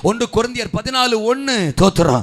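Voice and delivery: preacher urging the crowd, each line rising; fervent preaching. Acted. Pastor exhorting the congregation to desire gifts, fervent loud chant.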